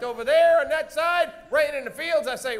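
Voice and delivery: ordinary delivery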